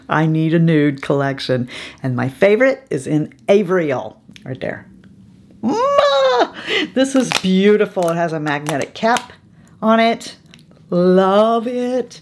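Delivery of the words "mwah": kiss sound. Real, not acted. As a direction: blow a big smooch. Blowing a kiss to lipstick.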